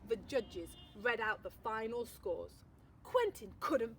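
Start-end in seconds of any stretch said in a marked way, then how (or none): none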